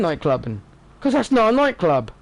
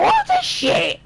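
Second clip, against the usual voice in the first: high, squeaky "What the shit?"; shocked outburst; slower than usual. Retold or imitated. Reacting to a cheesy song in the club, squeaky shock. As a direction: pitch the shock way up. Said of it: "You're like".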